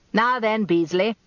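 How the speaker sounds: bold, commanding barrister; a confident old barrister on the attack. A narrator's reading. Rumpole opens cross-examination.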